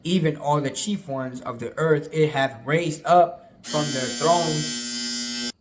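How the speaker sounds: measured, emphatic Bible reading; scripture reading. Acted. Preacher reads the prophecy to listeners, emphatic scripture reading. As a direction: read the verse out with weight.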